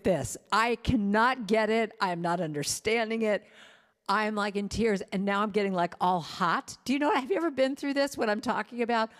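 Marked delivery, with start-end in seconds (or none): none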